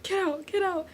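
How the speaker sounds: high, hushed mock warning; small high-pitched urgent quote; movie character's line. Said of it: "he was the one that was like".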